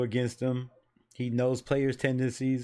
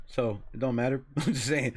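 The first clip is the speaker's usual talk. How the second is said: ordinary delivery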